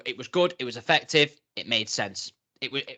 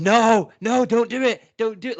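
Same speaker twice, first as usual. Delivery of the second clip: higher, louder pleading crowd chant; 6 semitones higher, 6 dB louder than usual. Retold or imitated. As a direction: beg him not to like a panto crowd. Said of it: "everyone's going".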